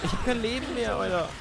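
high panicked voice; panicking gamer. Real, not acted. Out of health in game.

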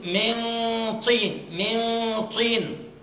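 من طين with the nun hidden in a heavy nasal; heavy ghunna. Shown as a demonstration. Teacher showing the class a heavy ghunna, slowly. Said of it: "الغنة مفخمة".

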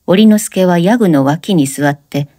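plain reading voice

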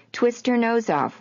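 high, slow playful command; hammed-up order to a toddler; mock order. Father urging Dylan to twist grandma's nose, mock command. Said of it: "Woody would order Dylan".

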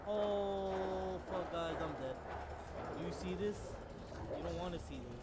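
high loud chaotic yelling; loud frantic shouting. Real, not acted. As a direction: yell through the chaos. Dying then cheering.

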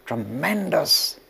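TREMENDOUS stretched slow and weighty; Tremendous drawn out to savour it; drawn-out emphasis. Shown as a demonstration. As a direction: lean hard into tremendous, slowly. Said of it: "tremendous attraction".

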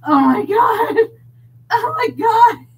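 high, loud cry-laugh of disbelief; can't believe it, crack up; laughing shriek. Real, not acted. Reader reacting to the story, laughing shriek.

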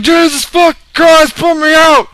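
high, shouted begging for rescue; panicked pig's plea. Pretend. Burning pig begging the player for rescue, mock-panicked shouting. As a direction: scream pleas in mock panic. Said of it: "He's like".

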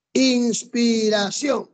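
"Inspiración" drawn out slowly, syllable by syllable; slow sounding-out. Shown as a demonstration. Teacher checking accent rules, "Inspiración" sounded out slowly. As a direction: stretch "Inspiración" so each syllable is heard.

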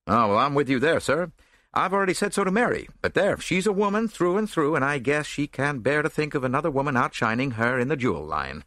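hearty actor, lightly voiced; big actor's voice. A narrator's reading. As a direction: bluff and boom it a little. Rolfe voiced again.

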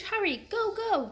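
high, urging call to the elephant; urgent call. A narrator's reading. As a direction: urge the elephant to run. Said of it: "Jack called to Morning Breeze".

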